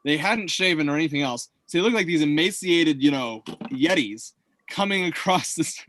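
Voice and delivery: ordinary talk, nothing marked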